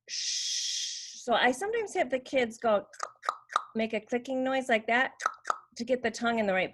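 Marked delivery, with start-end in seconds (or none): 0.1-1.2 long voiceless "Sh-"; SH sound. Shown as a demonstration. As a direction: blow "Sh-" through rounded lips. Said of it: "round your lips and blow".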